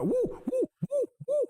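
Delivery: high-pitched hype whoops, woo woo; excited whooping. Real, not acted. Gamer cheering his own parry, giddy high whoops.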